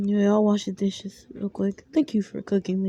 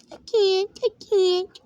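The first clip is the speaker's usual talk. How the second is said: much higher, tearful sob; a sick little girl breaking down; sobbing child; 11 semitones higher, slower than usual. Acted.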